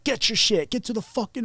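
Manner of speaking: high-pitched mock drill yell; retold screaming. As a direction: imitate guards yelling orders without full volume. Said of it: "started screaming in our face".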